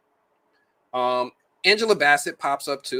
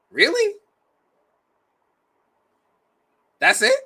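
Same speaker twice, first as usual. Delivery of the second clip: very high, louder rising questions; mock disbelief; 6 dB louder than usual. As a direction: squeak out an incredulous, let-down question. Acting out a viewer's disbelief.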